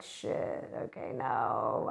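breathy whispered shit, then a low, slow, hushed okay no; whispering in retold panic; hushed dismay. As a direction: mutter it under your breath in dread. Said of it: "I'm like".

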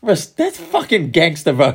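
high, slow, impressed exclaim. Retold or imitated. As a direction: gush about it, drawn out. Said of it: "I was just like".

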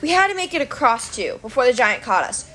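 plain reading voice

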